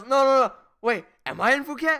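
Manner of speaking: hammed-up sudden doubt; panicked confusion. Imitating Harry doubting his location, hammed-up panic. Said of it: "He goes".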